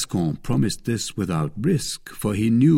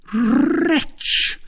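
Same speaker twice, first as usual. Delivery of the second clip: high, loud outraged cry; Milady cursing the absent rival, angry; 4 dB louder than usual. A narrator's reading. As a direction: spit the insult out. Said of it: "cried Milady".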